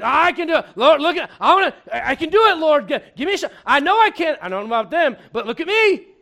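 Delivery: loud, high over-eager pleading; hammed-up Peter begging for a shot. Peter pleading with the Lord, loud and eager. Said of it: "And Peter's like".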